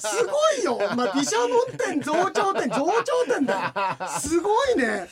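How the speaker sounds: loud, high exclamations through laughter; laughing mock outrage. Co-host reacting to a listener's cheeky request, laughing mock outrage.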